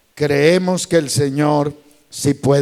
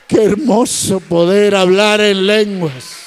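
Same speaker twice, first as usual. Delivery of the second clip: ecstatic preaching; 8 semitones higher, 6 dB louder than usual. Acted. Pastor exalting speaking in tongues to congregation, high shouted praise. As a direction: build to a rapturous shout.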